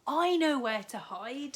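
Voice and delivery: keen and urgent; girl leading the escape; Katy's voice. A narrator's reading. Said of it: "called Katy".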